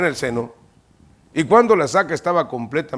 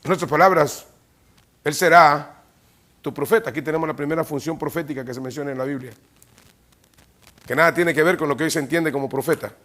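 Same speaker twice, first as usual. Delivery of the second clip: ordinary delivery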